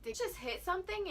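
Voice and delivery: high, quick alarmed question. Retold or imitated. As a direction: blurt it out high and fast. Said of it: "I was like".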